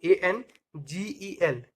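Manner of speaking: slow, careful letter-by-letter spelling; spelled out slowly. Shown as a demonstration. Teacher correcting a misspelled angel, slowly letter by letter. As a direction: give every letter its own beat.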